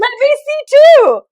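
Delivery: high, loud, drawn-out joking plea; playful eagerness. Pretend. Co-host pretends to peer at the other's desk, playfully eager. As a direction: play at nosy excitement, voice shooting up. Jokes about peering at co-host's desk.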